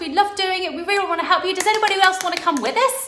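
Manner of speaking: high, loud, bubbly call to join in; retold eager pitch to the group; excited invitation. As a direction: gush like you can't wait for company. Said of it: "this energy of just like".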